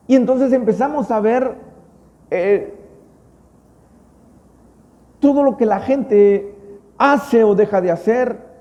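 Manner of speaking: normal talking voice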